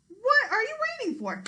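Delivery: very high, loud challenge; taunting goat. Acted. Big goat taunts the troll to come up, loud dare.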